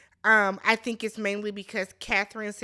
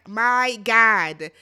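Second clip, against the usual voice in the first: exclaiming; 8 dB louder than usual. Real, not acted. Shocked at the breakup.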